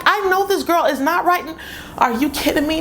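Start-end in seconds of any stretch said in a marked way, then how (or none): 0.1-1.6 outraged mother. Retold or imitated. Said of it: "So I was like".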